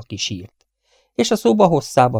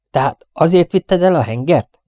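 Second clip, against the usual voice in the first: loud question; 6 semitones higher, 4 dB louder than usual. Acted. Captain interrogating.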